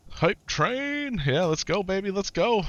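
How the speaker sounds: very high hype shout; hype cheer. Real, not acted.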